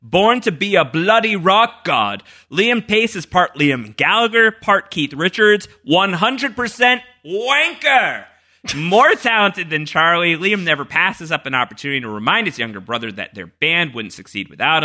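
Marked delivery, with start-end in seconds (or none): 0.0-11.5 reading a fan entry. Retold or imitated. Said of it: "this is from the great Ben Behind the Curtain, who writes".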